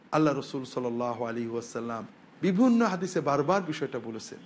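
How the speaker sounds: ordinary talk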